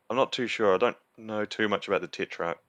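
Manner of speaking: ordinary talk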